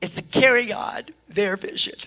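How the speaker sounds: choked-up whisper; emotional. Real, not acted. Pastor moved by the donors' legacy, emotional whisper. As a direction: barely get the words out through tears. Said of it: "I'm getting emotional".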